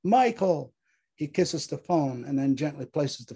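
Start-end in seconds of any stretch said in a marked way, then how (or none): 0.0-0.7 high, desperate calling of his name; acted scream, louder on the second call. A narrator's reading. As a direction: call after him, pitched well up. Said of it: "she screams".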